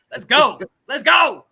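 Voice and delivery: loud, rising excited shout; hype shout. Real, not acted. Host hyping the season opener, excited shouting. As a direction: fire up the crowd.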